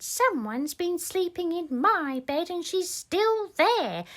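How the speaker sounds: put-on a small bear discovering the girl in his bed; Baby Bear. Baby Bear finds Goldilocks still in his bed, high little voice. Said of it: "then Baby Bear said".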